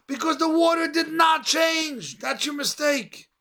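high-pitched rising preacher's call, held up top; preaching. Acted. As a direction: lift the congregation with a soaring sermon voice. Preacher builds to climax.

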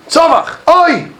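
high-pitched retold outcry; retold scream. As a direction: cry out as the anguished man, retelling it.

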